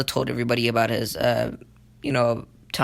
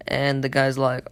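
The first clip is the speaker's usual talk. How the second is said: ordinary delivery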